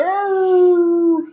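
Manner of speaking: high yelping growl; dog growl. Retold or imitated. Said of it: "And he'd be like".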